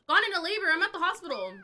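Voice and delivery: loud, high-pitched alarm; urgent exclamation. Real, not acted. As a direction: call out the sudden news urgently. Calling out sudden labor in game.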